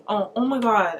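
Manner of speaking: ordinary talk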